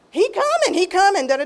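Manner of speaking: high, pleading insistence that fades out; insisting. Retold or imitated. New mother insisting to hospital ladies her husband will come, pleading and high. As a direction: keep insisting he's on his way. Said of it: "I said".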